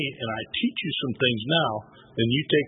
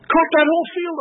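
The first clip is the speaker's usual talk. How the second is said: high, heated storytelling; hammed-up outrage in a raised pitch; mad; 3 dB louder, slower than usual. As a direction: retell it all worked up. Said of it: "I got so mad".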